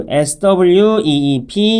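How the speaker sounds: slow letter-by-letter spelling; slow teacher spelling. Shown as a demonstration. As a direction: spell out P E E L one letter at a time. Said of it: "spelling the word out".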